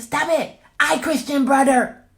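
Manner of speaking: put-on loud high toddler yell; toddler shouting.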